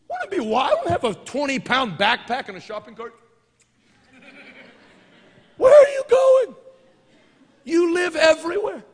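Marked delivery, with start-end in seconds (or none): 5.6-8.8 high, loud exasperated call; mock incredulous shout. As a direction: yell it in mock disbelief.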